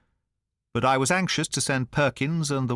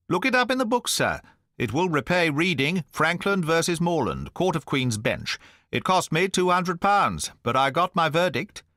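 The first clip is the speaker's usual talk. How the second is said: put-on higher, self-satisfied old litigant; pompous squire; 6 semitones higher than usual. A narrator's reading. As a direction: crow over every legal victory. Pompous squire boasting of lawsuits.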